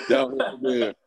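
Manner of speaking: laughing. Real, not acted. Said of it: "repeats it, laughing".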